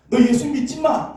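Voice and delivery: loud, high forbidding cry; shouted command. Retold or imitated. Parent forbidding the child faith, loud angry shout. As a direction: bark the ban at the child.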